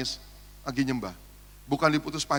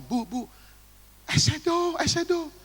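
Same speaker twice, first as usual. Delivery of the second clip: urgent hint; 12 semitones higher, slower than usual. Retold or imitated. Signaling the worship leader.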